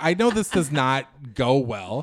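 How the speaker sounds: telling the hosts why he knew it would end badly; emphatic aside. Real, not acted. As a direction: hammer it in with a knowing, slow punch.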